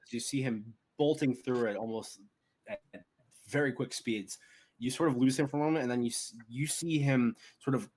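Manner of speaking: normal talking voice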